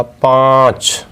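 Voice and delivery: पाँच drawn out slowly through the nose; nasal vowel. Shown as a demonstration. Teacher showing children a nasal vowel, slowly. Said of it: "नाक में बोले जाने वाला".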